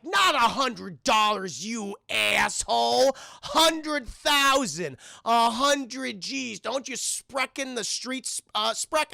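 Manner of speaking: slow, loud, offended yelling; angry yell. Retold or imitated. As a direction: spit each word at him slowly. Dealers offended, yelling at Mel.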